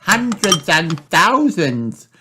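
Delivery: high, slow, drawn-out exclamation; little girl amazed at how many; excited cry. Acted. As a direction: cry it out high, stretching each word. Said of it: "cries Peppa".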